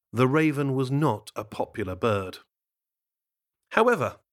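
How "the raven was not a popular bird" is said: usual speaking voice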